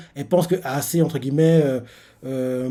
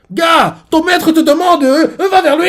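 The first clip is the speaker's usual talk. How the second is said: hammed-up call; 13 semitones higher, 9 dB louder than usual. Character ordering the boy to his master, loud goofy yell.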